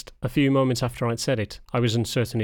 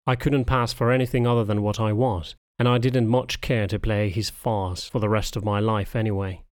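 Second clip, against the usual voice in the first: ordinary delivery, nothing marked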